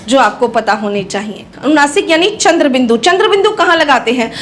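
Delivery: ordinary talk, nothing marked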